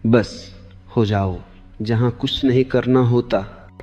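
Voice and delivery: usual speaking voice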